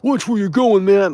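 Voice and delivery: high, loud yell; mock angry driver. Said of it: "then he tried to".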